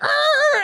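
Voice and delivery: a high mock pirate arr; playful pirate roar; pirate growl. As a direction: growl it out like a pirate leaping out of a truck. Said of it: "it's like".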